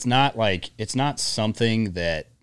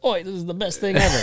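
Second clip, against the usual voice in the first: high-pitched, badly faked British accent; hammed-up British lady, pitched way up; terrible British accent; 11 semitones higher than usual. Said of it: "That's a terrible British accent".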